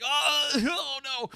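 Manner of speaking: high, slow, laughing whimper of despair; drawn-out, high, hammed-up wail; mock despair. Retelling lying sick on floor.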